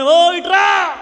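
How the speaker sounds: loud, high call across the street; mock shouted call. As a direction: shout to the fortune-teller to come. Woman calls fortune-teller over.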